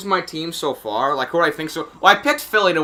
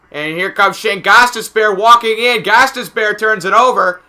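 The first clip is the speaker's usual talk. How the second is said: higher, energized play-by-play; excited call; 6 semitones higher, 6 dB louder than usual. Real, not acted. Announcer calling Gostisbehere walking in, excited play-by-play. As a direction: lift the voice as the attack comes in.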